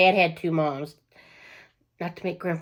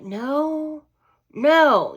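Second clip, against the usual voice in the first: emphatic no; 9 semitones higher, 4 dB louder than usual. Retold or imitated. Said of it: "I'm like".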